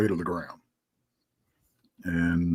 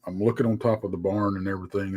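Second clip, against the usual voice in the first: ordinary delivery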